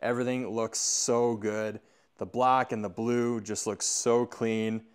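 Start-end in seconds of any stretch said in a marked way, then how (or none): none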